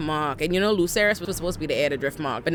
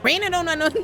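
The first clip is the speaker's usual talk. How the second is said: high, scoffing put-down; high mocking scoff; dismissive mock; 11 semitones higher than usual. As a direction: scoff at Rhaena. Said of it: "he was just like".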